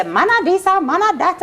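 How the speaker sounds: mocking the critics. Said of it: "Everybody always say".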